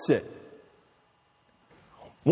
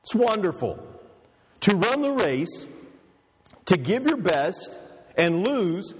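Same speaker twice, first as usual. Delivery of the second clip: usual speaking voice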